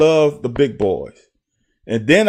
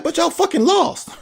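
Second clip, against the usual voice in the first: imitating mom. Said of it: "She's like".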